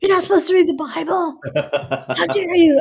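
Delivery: hammed-up furious mom shouting up the stairs; mother yelling. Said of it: "all yelling up and down the stairs".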